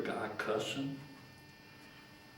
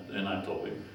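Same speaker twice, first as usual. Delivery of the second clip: ordinary talk, nothing marked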